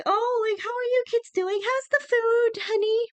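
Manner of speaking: high, sweet mock troll mother calling her husband; a high hammed-up troll wife, sweet then shouting honey; Grýla voice. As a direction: play sugary Grýla, then call Honey! shrill and high. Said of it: "if Grýla's like".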